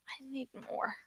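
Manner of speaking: desperate breathy whisper; hushed, needy whisper. Acted. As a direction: whisper it like an addict craving a fix. Craving more of the books.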